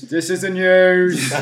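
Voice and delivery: loud, slow, drawn-out segment announcement; hammed-up booming intro; mock news announcer. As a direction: stretch the last word out like a jingle.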